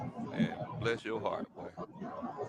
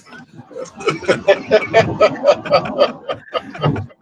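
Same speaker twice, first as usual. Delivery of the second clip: laughing; 4 semitones higher, 4 dB louder than usual. Real, not acted. Laughing at own joke.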